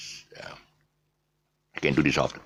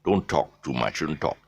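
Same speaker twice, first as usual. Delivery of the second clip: ordinary talk, nothing marked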